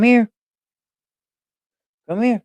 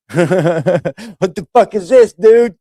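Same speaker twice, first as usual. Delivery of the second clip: voicing the cat; 4 semitones higher, 4 dB louder, much slower than usual. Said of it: "be like".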